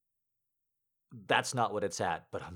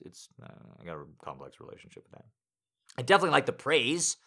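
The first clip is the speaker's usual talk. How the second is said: normal talking voice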